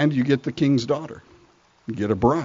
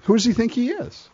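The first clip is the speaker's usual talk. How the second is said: put-on higher, indignant young David; cocky young David; 9 semitones higher than usual. David scoffing at the giant, higher and indignant. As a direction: toss it off like a brash teenager. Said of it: "David says".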